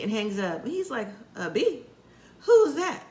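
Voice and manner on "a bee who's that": incredulous and amused, half laughing; hammed-up shocked boyfriend; mock disbelief. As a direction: pitch jumps high with a laugh on who's. [name] voiced, mock outrage.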